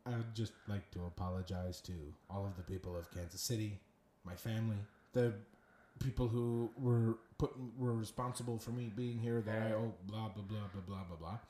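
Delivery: low, quiet, mumbled official apology; mock formal apology. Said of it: "he apologizes. So he's like".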